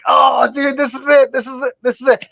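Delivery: high, thrilled outburst, repeating it; excited discovery. Retold or imitated. To himself, finding the rare boa in the roots, excited high yelp. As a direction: yelp with the joy of finally finding it.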